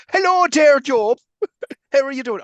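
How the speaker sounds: high, loud hammed-up Irish greeting; mock Irish accent. Mocking the actor's Irish accent, high stage Irish greeting. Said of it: "Why is he doing an Irish".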